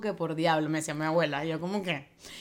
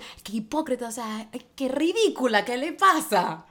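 mock outraged gossip, higher and louder at the end; 5 semitones higher, 4 dB louder than usual. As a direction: sneer it like a catty friend. Burla de alguien criticando. (chismosa burlona imitando indignación)

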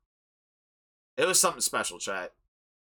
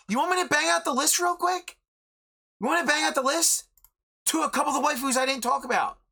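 high-pitched, rising excitement; hyped-up; 8 semitones higher than usual. Real, not acted. Podcaster banging out the waifu list for listeners, hyped-up. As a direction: rattle off a roll call, pitched way up.

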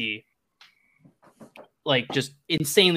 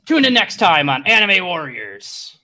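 slower, louder, raised announcer sign-off; show announcer; 4 semitones higher, 6 dB louder than usual. Acted. Narrator signs off anime episode for viewers, announcer voice. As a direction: announce it like an episode's closing line. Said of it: "hear the words".

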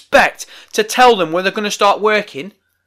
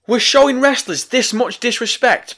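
normal talking voice

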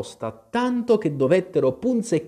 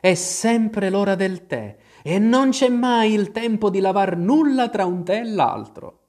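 much higher, weary hatter; Mad Hatter; 10 semitones higher, 5 dB louder than usual. Acted. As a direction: lament the endless tea. Hatter complains. (cappellaio stanco)

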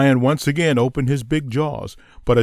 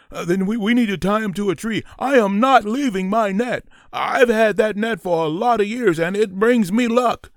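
higher, fast proud hunter; 8 semitones higher than usual. Acted. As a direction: boast about the lucky net. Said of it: "Vinny said proudly".

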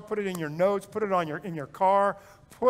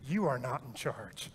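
humble inner words; 7 semitones lower, 8 dB quieter than usual. Pretend. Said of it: "be humble enough to say".

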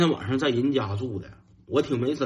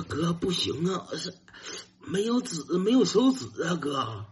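pleading; 4 semitones higher, 4 dB quieter than usual. Retold or imitated. Little brother pleads about no paper.

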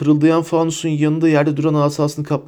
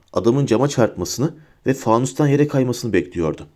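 ordinary delivery

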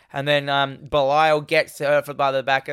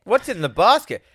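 demanding question; 6 semitones higher, 4 dB louder than usual. Retold or imitated. Echoes the acted movie line.

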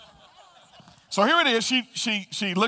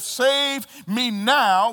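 drawn-out, slow demanding plea; urgent plea; 5 semitones higher, 4 dB louder, much slower than usual. Acted. One thief demanding rescue, slow urgent plea. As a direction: stretch out now, demanding. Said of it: "One said".

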